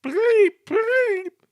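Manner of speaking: high, loud spluttered bleeps; spluttering whistle. A narrator's reading. Drenched engine whistles for help, high loud splutter. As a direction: sputter like a soaked whistle. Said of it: "he spluttered".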